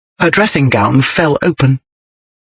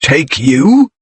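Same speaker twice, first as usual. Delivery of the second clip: voice climbing. A narrator's reading. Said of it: "His voice climbed".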